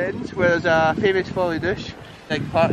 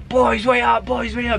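mock shout; 6 semitones higher than usual. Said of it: "shouting to his pals".

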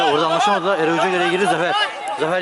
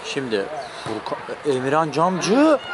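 usual speaking voice

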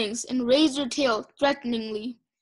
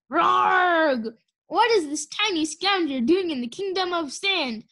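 mock-regal roar; 5 semitones higher than usual. Sunny plays Queen Oasis facing the scavenger, hammed-up roar. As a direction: play-act an outraged dragon queen. Child dragon playing a roaring queen.

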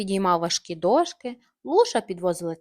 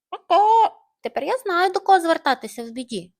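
proud hen; 8 semitones higher, 8 dB louder than usual. Acted.

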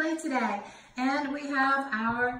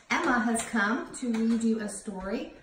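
usual speaking voice

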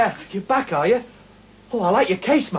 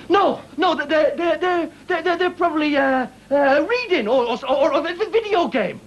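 flustered stammer; 7 semitones higher, slower than usual. Acted. Covering up an awkward hint.

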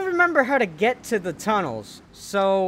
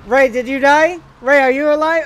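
worried calling out; 4 semitones higher, 7 dB louder than usual. Real, not acted. Partner NPC hit, checking.

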